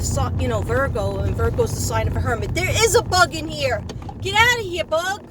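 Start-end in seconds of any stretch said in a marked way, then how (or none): 2.5-5.2 higher and louder, shooing a bug away; sudden real shout at an insect; startled shout at a bug. Real, not acted. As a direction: yell at the bug to get out.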